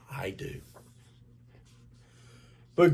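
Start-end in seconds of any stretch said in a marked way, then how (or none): 0.1-0.6 soft whisper. A narrator's reading. Mother whispering to Grover, soft whisper. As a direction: breathe it gently.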